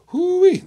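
high, loud whooping holler; excited whoop. Real, not acted. Auctioneer whooping to rev up bidders, loud. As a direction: let out a big hoo-wee.

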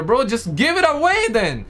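ordinary talk, nothing marked

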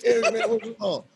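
laughing through a casual greeting. Retold or imitated. Narrator retelling greeting Eric, laughing. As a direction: chuckle while asking. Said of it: "So I said".

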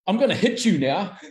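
high, loud mock tantrum; hammed-up petulant threat; childish threat. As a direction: act out losing control like a little kid. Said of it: "emotionally he started to lose the control".

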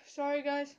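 ordinary delivery

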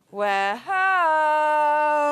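high, loud homecoming holler; drawn-out happy call. Real, not acted. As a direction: announce your return to everyone, loud and high. Calling out on return.

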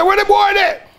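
high, loud taunt at the crowd; taunting call. Retold or imitated. Baiting the chasing crowd, a high loud taunt. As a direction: yell it high to bait them.

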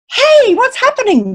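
high-pitched, alarmed question; sudden jump up in pitch; startled rat. Acted. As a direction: cry out as a puzzled little rat.

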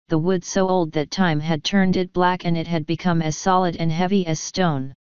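ordinary delivery, nothing marked

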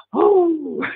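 high, breathy-free swoon on Oh; swooning girls. Retold or imitated. Girls swooning over the handsome prince, high swoon. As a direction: squeal it like an admiring girl. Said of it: "all the girls would be going".

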